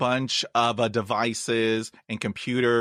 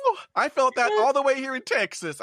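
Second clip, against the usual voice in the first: high, fast exclamation; excited, host moved by the answer; 12 semitones higher, faster than usual. Real, not acted. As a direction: gush with feeling.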